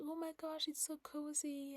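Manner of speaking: light imitation of a happy daughter; delighted little girl.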